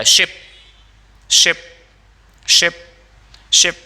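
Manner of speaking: "शिप" with a short i; brief "शिप", repeated with pauses; short vowel in शिप. Shown as a demonstration. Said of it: "श में ई की मात्रा".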